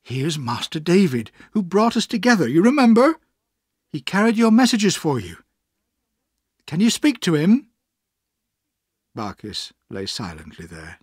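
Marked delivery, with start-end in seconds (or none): 0.0-7.7 high-pitched, eager coaxing; rising, brightly encouraging wife; almost cheerful. A narrator's reading. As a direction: coax him to respond, pitched up. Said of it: "she said almost cheerfully".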